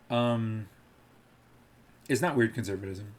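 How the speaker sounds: ordinary talk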